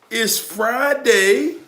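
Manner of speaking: "Friday" stretched very slow, pitched high and rising; drawn-out hype. Real, not acted. Hyping up his viewers, slow and high. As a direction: sing out "Fri-day" long and high.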